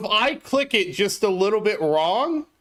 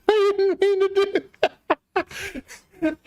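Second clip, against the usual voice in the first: high, slow, laughing through the words; embarrassed laugh; 11 semitones higher, slower than usual. Real, not acted. Streamer to chat after a misclick, laughing in embarrassment.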